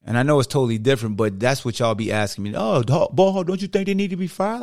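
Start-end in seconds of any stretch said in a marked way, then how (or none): 2.6-4.6 higher, pushy fan voice, lightly done; a hint of a fan demanding a firing; mock nagging fan. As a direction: lightly imitate a fan pestering him. Fan asking him coach be fired.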